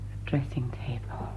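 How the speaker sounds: soft secret whisper; low whisper while sneaking. Acted. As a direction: whisper it so no one wakes. Searching room secretly.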